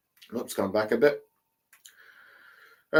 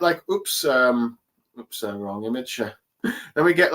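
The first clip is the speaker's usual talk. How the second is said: ordinary talk, nothing marked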